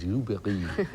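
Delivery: fake French accent. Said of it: "I can do fake French".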